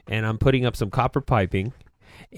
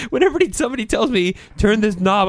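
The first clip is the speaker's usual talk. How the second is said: laughing; 9 semitones higher, 3 dB louder than usual. Real, not acted. Laughing while telling it.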